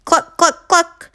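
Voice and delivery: high, quick clucks; crying hens. Acted. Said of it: "the hens cried".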